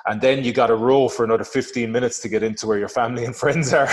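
normal talking voice

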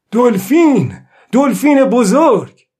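high, loud call on sighting dolphins; loud cry. Acted. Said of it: "با صدای بلند گفت". (فریاد بلند)

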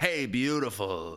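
slowed, crooning pickup line; slow smooth chat-up. Retold or imitated. Picturing himself hitting on a woman in a pub, slow and smooth. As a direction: lay it on like a pub charmer. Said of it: "I wouldn't be like".

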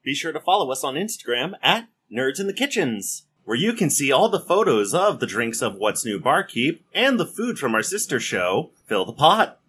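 loud upbeat podcast plug; outro announcement. Acted. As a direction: announce the socials like a host.

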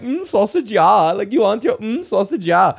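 high, sing-song teasing voice; mocking kid voice. Schoolkids taunting him about sausage camp, high mocking sing-song. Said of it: "they did that voice to me".

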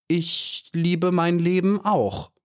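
high, slow, drawn-out reply; clever, hesitant. A narrator's reading. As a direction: hold back shrewdly, taking your time. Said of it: "klug und zögernd".

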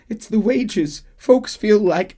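high, slow, timid voice for the union man; high and slow, nervously pleading; nervous Didsbury. Retold or imitated. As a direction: squeak it out like a nervous little boss. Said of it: "is saying".

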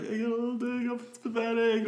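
high, far-off put-on voice for the pathetic line; quoting Bill Paxton. Podcast host imitating the actor's pathetic character, high put-on voice. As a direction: squeak it out like a whiny wimp. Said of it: "but he's like".

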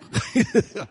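high, short burst of laughter; amused high-pitched laugh. Real, not acted. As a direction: chuckle at your own joke. Laughs at own shoe joke.

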